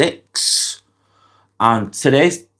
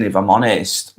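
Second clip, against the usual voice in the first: ordinary talk, nothing marked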